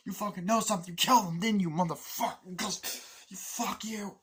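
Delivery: high, strained mock rage; re-enacted violent accusation; acted furious outburst. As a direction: rant like a soldier losing control. Acts out soldier's violent outburst.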